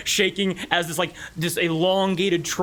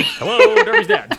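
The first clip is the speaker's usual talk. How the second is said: squeaky high hello with a laugh; high laughing greeting; 9 dB louder than usual. Real, not acted. Greeting friend's dad, laughing.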